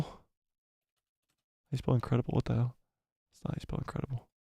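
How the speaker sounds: soft, half-whispered typing mutter; muttering to himself. Real, not acted. Streamer typing a tweet, muttering quietly. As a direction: mumble while typing under your breath.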